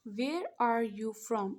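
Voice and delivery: "Where are you from?" drawn out word by word; very slow dictation. Shown as a demonstration. Teacher slowly dictating the English question to students. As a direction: spell it out slowly as you write. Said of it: "तो हम पहले लिख लेते हैं".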